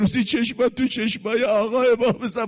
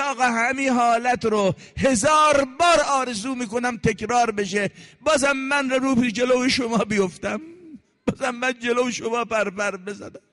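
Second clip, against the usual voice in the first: higher, impassioned pleading cry; dying Zuhayr's cry; 5 semitones higher than usual. Acted. Dying Zuhayr telling Husayn he would die again, higher fervent cry. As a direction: plead with your master through the pain.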